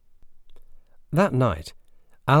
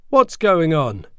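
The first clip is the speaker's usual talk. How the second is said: loud, high angry call down the stairs; father shouting down at a noise in the night; 8 semitones higher, 9 dB louder, slower than usual. Acted. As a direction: demand it angrily from the top of the stairs. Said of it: "asked angrily".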